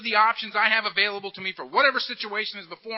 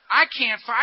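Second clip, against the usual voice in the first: ordinary talk, nothing marked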